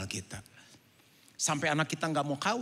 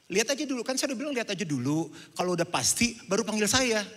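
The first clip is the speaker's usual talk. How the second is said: curt waiter; 9 semitones higher, 6 dB louder than usual. Retold or imitated. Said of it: "Itu yang pelayannya".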